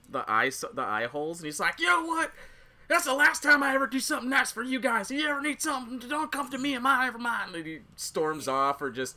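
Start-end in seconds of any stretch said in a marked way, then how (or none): 1.8-7.5 high-pitched, hurried imitation of a huffy complainer; comic aggrieved whine before storming off; mock indignant rant. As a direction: act out an offended outburst, voice pitched way up.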